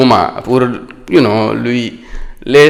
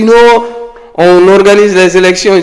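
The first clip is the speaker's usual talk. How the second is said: imitated friend's higher-pitched rebuttal; retold protest; 6 semitones higher than usual. Friend insists on elections to the doubting speaker, imitated higher-pitched protest. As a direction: pitch the quoted "no" up, as in argument. Retelling the friend's objection in argument.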